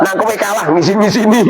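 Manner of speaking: high-pitched, needling tease; teasing scold. Real, not acted. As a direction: tease them, pitch riding up. Teasing students about losing contests. (ngeledek dengan nada tinggi)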